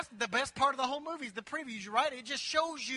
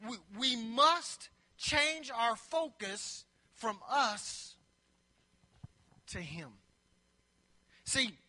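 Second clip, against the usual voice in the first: solemn slow preaching; slow, dropping; 3 semitones lower, slower than usual. Acted. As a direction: draw it out and let it sink in. Slow dramatic preaching close.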